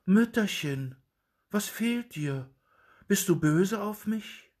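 reach out to his mother kindly; tender boy's plea. A narrator's reading. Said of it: "legte traulich seine Hand auf ihren Arm und sprach".

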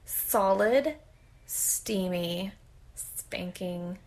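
word by word with pauses; slow and fading; slow and deliberate. Shown as a demonstration. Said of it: "Can we say that one more time?".

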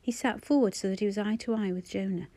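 ordinary delivery

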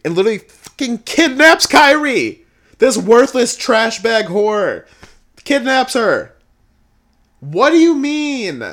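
high, loud, incredulous yelling; outraged rant. Real, not acted. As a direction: yell in outrage, stretching the words.

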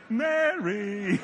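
long, hammed-up comic call of the name; silly-voiced Mary. Shown as a demonstration. Preacher guesses how Jesus said Mary, silly voice. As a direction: stretch the name in a silly put-on voice. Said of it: "Or did he say".

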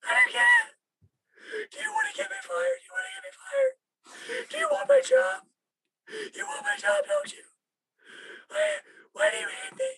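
high falsetto sobbing wail, shouting through tears; squeal cry. Retold or imitated. Imitating the crying client accusing the crew, falsetto wail. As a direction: squeal and cry like a man who thinks he's being fired. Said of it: "a grown man squeal cry".

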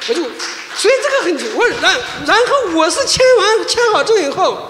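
chuckling with relief; laughing. Real, not acted. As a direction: laugh as you tell the happy ending. Laughing while telling the visa story.

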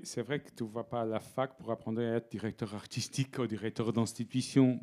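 normal talking voice

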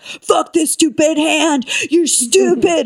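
high, drawn-out mock fury; hammed-up angry rant. Comedian cursing her own hand for laughs, mock-angry. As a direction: rage comically at a useless hand.

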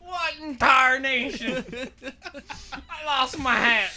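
put-on fussing old coot; old prospector. Old-timer exclamation bit.

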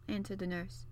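usual speaking voice

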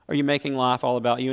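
ordinary talk

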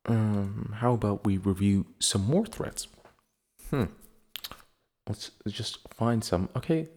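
ordinary delivery

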